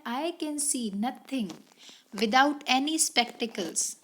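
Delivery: reading the example very slowly; slow read-aloud. Shown as a demonstration. Teacher reading the example sentence to students, very slow. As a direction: spell out each word for the class. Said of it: "इसने पहला करके दिखाया है".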